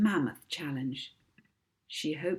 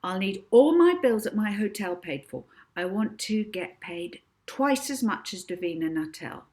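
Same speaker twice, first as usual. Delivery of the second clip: demanding Molly; 3 semitones higher, 7 dB louder than usual. Acted. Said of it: "continued Molly".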